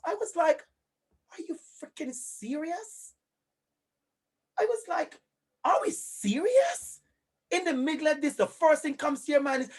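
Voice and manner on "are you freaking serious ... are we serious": drawn-out, disbelieving higher-pitched question; incredulous. Retold or imitated. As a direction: ask it slowly, in disbelief. Retelling own incredulous reaction.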